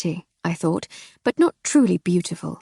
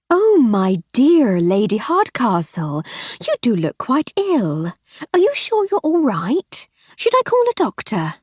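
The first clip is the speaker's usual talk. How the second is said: fake concern; 5 semitones higher, 4 dB louder than usual. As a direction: pretend to fret. Mrs Seddon false concern.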